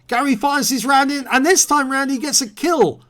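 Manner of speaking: excited call. Real, not acted. Caster announcing a teammate's kill to viewers, excited and loud. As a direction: sell the kill like a live caster. Commentator calls a kill shot.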